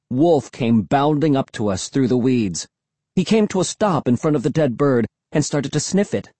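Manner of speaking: ordinary delivery, nothing marked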